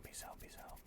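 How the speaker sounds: normal talking voice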